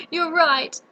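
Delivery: half-laughing, half-crying admission. Acted. Cowardly lion sobbing to Dorothy, laughing through tears.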